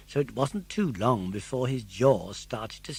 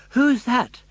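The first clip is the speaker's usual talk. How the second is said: gasped; 11 semitones higher, 9 dB louder than usual. Acted. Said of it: "gasped Pinocchio".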